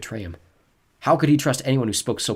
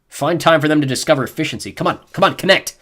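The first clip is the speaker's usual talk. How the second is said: muttering; 6 semitones higher, 9 dB louder than usual. A narrator's reading. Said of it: "Jen's dad muttering".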